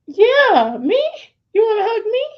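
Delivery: put-on very high, loud little-boy voice; squeaky, incredulous kid impression; astonished young son. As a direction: imitate a child squeaking in disbelief. Acts out her astonished son.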